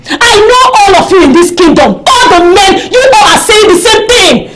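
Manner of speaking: heated, scornful shouting; angry accusation. Acted. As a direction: denounce the lot of them. Accuses all men in quarrel.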